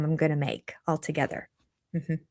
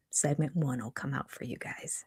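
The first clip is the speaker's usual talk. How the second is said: soft whispered promise; 5 semitones lower, 11 dB quieter than usual. Real, not acted. Vlogger quietly promising the first segment, whisper. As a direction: whisper it to the viewers.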